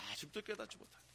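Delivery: 아직도 깨닫지 못하느냐 dropped to a hush; near whisper. Shown as a demonstration. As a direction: let the title fade into a hush. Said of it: "오늘 제목이 뭐예요?".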